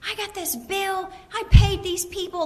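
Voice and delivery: high-pitched mock screaming caller; hammed-up angry complaint; furious caller. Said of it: "Somebody could call the hospital screaming".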